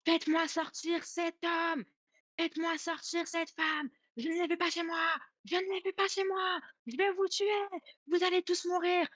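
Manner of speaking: high, drawn-out mock wail at ordinary volume; imitated possessed screaming. Possessed aunt ordering the visitors out of her house, in an imitated high-pitched scream. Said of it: "elle était dans le coin de la cuisine et elle hurlait".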